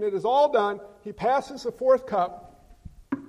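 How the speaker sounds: ordinary talk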